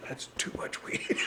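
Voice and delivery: "That's too much weed." whispered, no voice at all; whispered aside. Acted. Repeats joke line as whisper.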